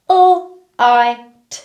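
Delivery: L, I, T said as separate sounds; sounding out words. Shown as a demonstration. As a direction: sound out L, I, T one by one. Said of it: "I'm going to sound out each word".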